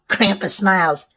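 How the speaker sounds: ordinary delivery, nothing marked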